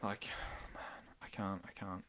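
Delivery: sighing whisper, choked up; whispered, emotionally spent; overwhelmed whisper. Real, not acted. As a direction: let it out in a weary whisper. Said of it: "Jesus Christ".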